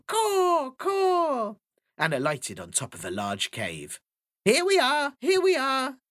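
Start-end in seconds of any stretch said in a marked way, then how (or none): 0.1-1.6 high, loud bird caw; magpie caw. Acted. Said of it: "the magpie let out a loud caw". 4.4-6.0 high, loud, chirpy bird call; magpie voice. Acted. Magpie arrives at the cave.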